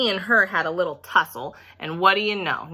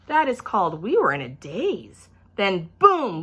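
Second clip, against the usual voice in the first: usual speaking voice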